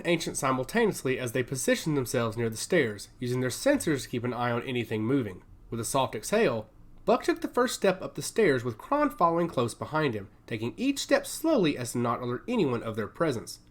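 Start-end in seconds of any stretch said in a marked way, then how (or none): none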